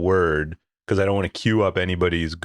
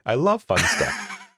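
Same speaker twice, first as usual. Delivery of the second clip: sing-song tease; 3 semitones higher, slower than usual. Pretend. Teasing sing-song repeat.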